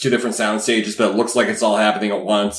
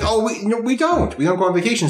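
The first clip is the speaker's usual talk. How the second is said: lightly voiced cheerful doctor; 9 semitones higher than usual. Doctor quoted, higher voice.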